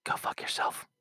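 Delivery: hushed, breathy whisper; whispered aside. Real, not acted. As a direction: drop to a whisper for the jab. Aside aimed at neighbor.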